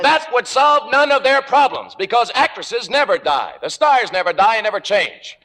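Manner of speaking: angry outburst. Acted. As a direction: throw it back at her in a rising fury. Playwright quarrels with Margo.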